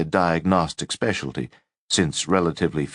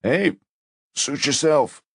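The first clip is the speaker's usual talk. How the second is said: casual shrug; 9 semitones higher than usual. A narrator's reading. Levine shrugging off refusal.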